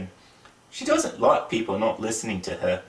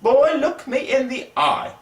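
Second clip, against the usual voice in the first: much higher and louder stern command; angry teacher; 12 semitones higher, 4 dB louder than usual. Acted. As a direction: scold the boy with rising anger. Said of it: "made him very angry".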